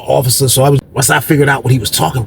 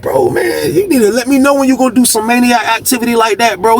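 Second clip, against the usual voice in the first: much higher, pressing disbelief; scolding his buddy for pulling a crazy stunt; exasperated scolding; 9 semitones higher than usual. Retold or imitated. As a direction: tell him off, wound up and amazed. Scolding friend for reckless move.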